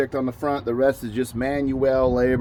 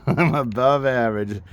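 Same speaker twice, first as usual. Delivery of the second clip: laughing. Real, not acted. Laughs at son's teasing.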